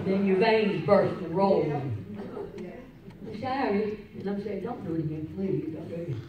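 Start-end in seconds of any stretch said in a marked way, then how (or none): 1.7-4.0 soft mock apology. Nurse quoted in hospital. 4.6-6.2 quiet pleading. Retold or imitated. Pleading retold from hospital.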